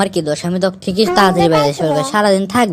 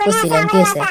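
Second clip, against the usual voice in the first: cheeky taunt. Acted. Nephew's cheeky taunt to uncle.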